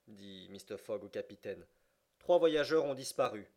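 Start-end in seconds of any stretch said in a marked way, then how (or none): 2.2-3.5 urgent report. A narrator's reading. Fogg urgently tells the captain.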